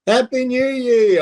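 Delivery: high, loud, drawn-out cheer; mock celebration. Joking to friends about tripping, a hammed-up cheer. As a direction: toast the new year with mock glee.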